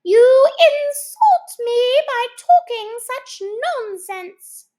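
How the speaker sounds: high, loud, slow indignation; insulted mouse. Acted. Insulted mouse walking away from Alice, loud and slow. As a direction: stalk off, deeply offended.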